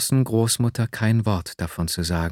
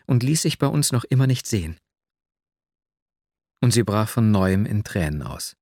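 plain reading voice

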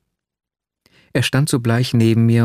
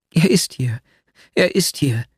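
higher-pitched, insistent agitation; agitated interruption; 5 semitones higher than usual. A narrator's reading. Nastjenka interrupts the narrator about her returned lover, agitated. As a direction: cut in with raised pitch, agitated. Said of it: "fiel mir Nastjenka erregt ins Wort".

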